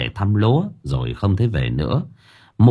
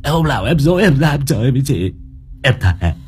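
frantic, whining excuses; terrified pleading old man; 4 semitones higher than usual. Acted. Old man makes excuses to the ghost, frantic begging. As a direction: swear desperately, pleading to be let go.

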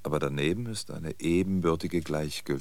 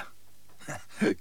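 short breathy scornful chuckle; bitter laugh; 6 semitones higher than usual. Acted. Old man scorns buyable reason, bitter laugh.

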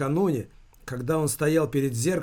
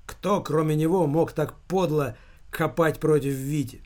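plain reading voice

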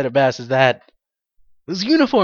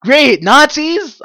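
loud, higher, thrilled outburst; gleeful loud cheer; excited; 6 semitones higher, 7 dB louder, slower than usual. Retold or imitated. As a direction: burst out with glee. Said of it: "I was, like, so excited".

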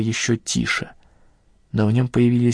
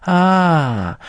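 high, louder piercing cry of suspicion; high sharp cry; 7 semitones higher, 4 dB louder than usual. A narrator's reading. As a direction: shriek it out sharply in a higher voice. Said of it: "неожиданно высоким и резким голосом".

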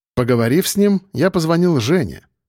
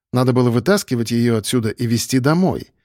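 ordinary delivery, nothing marked

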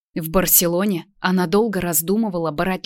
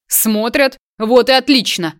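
ominous, loud and higher; mock evil villain; 5 semitones higher, 6 dB louder than usual. A narrator's reading. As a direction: gloat like a villain. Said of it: "зловещим голосом".

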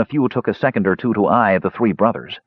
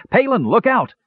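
high-pitched warning cry; urgent cry. A narrator's reading. Brother warning Palin of an attack, urgent cry. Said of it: "heard Tannen cry".

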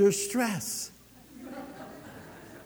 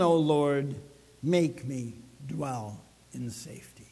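scripture reading; 5 semitones lower than usual. Shown as a demonstration. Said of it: "I love Psalm four eight".

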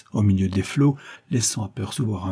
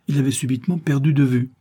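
usual speaking voice

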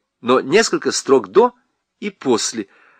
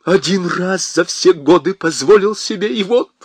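much higher, loud, furious; shrieking blame; 10 semitones higher, 4 dB louder than usual. Acted. Husband screeches blame at his wife. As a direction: screech every accusation.

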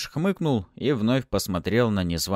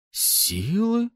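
high, rising one-word question; astonished; 13 semitones higher than usual. A narrator's reading. Newcomer hearing of power humans get, astonished. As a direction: echo the word high in surprise. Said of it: "округлила глаза от удивления".

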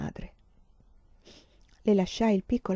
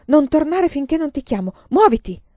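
urgent mother's command, louder and higher; ordine urgente; 5 semitones higher, 6 dB louder than usual. A narrator's reading. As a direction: send the child away urgently, ending loud on the last word. Madre fa fuggire la figlia. (ordine materno incalzante)

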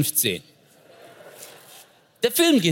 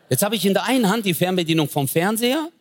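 ordinary talk

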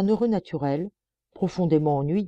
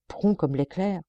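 plain reading voice

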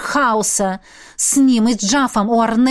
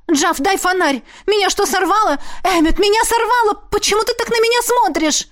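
high, alarmed voice climbing as it goes; rising panic in urgent questions; panicked; 10 semitones higher than usual. A narrator's reading. Героиня в панике перед Эмметом. (встревоженно, всё выше)

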